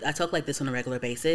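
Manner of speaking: showing off the real low speaking voice without pitching it up; regular deep voice. Shown as a demonstration. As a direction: let the voice sink low and rush it. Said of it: "pitch my voice a little bit up high".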